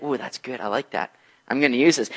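savoring a sneaky plan under your breath; mock scheming churchgoer, quietly gleeful; devious delight. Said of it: "It's devious".

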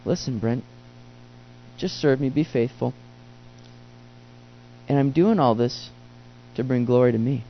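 low, soft, calm voice of God. Retold or imitated. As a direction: speak gently from above. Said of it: "God says".

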